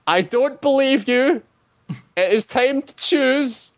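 very high, drawn-out creature voice; goblin king. Acted. Goblin king answers the party's flattering speech, high slow creature voice. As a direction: pronounce it slowly as a high-pitched goblin king. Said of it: "and the king goes".

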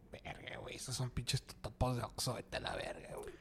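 very soft unvoiced whisper of disgust. Judging the chilaquiles served there, whispered disgust. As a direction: mutter it under your breath. Inner reaction to bad chilaquiles.

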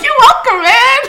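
high, laughing bro voice; laughing hype. Retold or imitated. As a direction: laugh it out proudly. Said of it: "I'm like".

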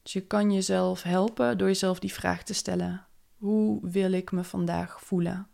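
calm, unhurried meditation voice; guided meditation. Acted. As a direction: guide them gently into the day. Leads a morning meditation. (rustige meditatiestem)

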